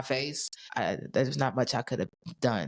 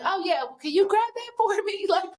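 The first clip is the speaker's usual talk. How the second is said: joking; 3 dB louder than usual. Retold or imitated. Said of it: "I'm a funny person".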